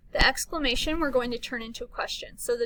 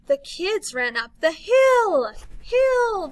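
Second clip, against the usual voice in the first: louder, much higher on hill; excited; 6 semitones higher, 7 dB louder, slower than usual. Shown as a demonstration. Teacher reading an exclamation to kids, excited. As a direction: shout the hill with joy. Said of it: "We're excited".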